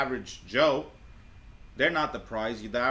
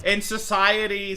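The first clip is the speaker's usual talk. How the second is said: higher, louder, drawn-out mimicry; mocking echo; 9 semitones higher, 8 dB louder, slower than usual. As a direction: echo her words mockingly, slow and loud. Mocking echo of the played clip.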